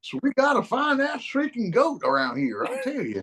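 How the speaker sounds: put-on very high country old-timer twang; mock country accent. Townsperson urging a search for the goat, mock country accent. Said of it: "I'm doing a country accent".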